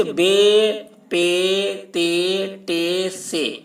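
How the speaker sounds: بے، پے، تے، ٹے، سے with long e; مجہول pronunciation. Shown as a demonstration. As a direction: draw out each letter on an "ay" vowel. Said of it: "ان پانچوں حروف کو اردو میں مجہول پڑھا گیا". (مجہول تلفظ، لمبی یائے مجہول)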